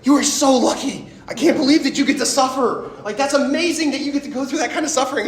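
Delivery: high, excited, gushing congratulations; acted amazement. Congratulating someone on getting to suffer, excited and amazed. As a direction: exclaim it high and loud, marvelling.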